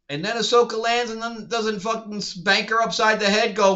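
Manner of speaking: ordinary delivery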